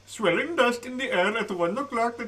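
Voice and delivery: quick mock Indian accent, laughing. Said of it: "No, Indian".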